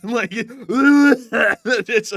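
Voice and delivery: cracking up mid-sentence; high, loud burst of laughter; laughing. Real, not acted. Streamer laughing at his co-host's joke, cracking up.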